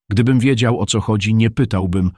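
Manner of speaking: plain reading voice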